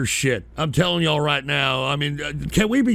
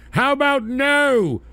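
mocking refusal; 7 semitones higher, slower than usual. Refusing a chat request.

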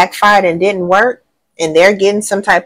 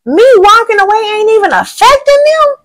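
much higher, louder squeal of disbelief; mock shocked ex; 14 semitones higher, 4 dB louder than usual. Reader voicing the ex watching you thrive, high mock shock. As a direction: play the stunned ex, pitch way up. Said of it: "They like".

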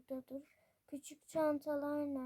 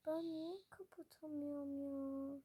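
usual speaking voice

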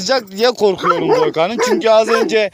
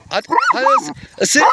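high urging; faster than usual. Real, not acted. Urging snake to bite.

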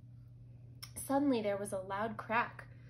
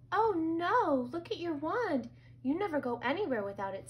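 higher-pitched storybook alarm; dismayed; 7 semitones higher than usual. A narrator's reading. Brother sees his sister's broken wand, dismayed. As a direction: exclaim in childlike dismay.

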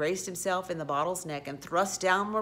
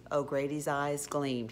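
ordinary delivery

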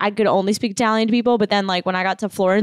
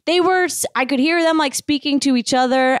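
normal talking voice

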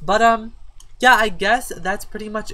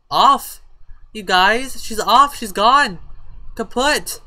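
higher, louder, she's gone; excited announcement; 6 semitones higher, 7 dB louder, slower than usual. Real, not acted. Player telling viewers her daughter just left, excited and loud. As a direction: call it out like big news.

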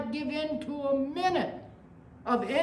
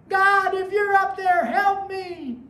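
high, loud desperate plea to God; man on the cliff begs God to save him; 9 semitones higher, 7 dB louder than usual. Retold or imitated. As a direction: beg heaven for rescue.

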